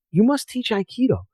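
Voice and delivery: imitating an old sensei begging him; pleading Japanese master.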